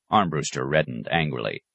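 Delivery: plain reading voice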